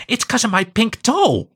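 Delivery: put-on high little girl explaining; bright small child explaining. A narrator's reading. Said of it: "Jessica explained".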